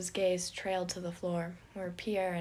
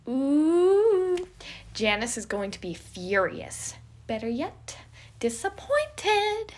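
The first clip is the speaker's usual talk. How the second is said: cackling glee; 7 semitones higher, 8 dB louder, slower than usual. Acted. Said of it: "Remus cackled".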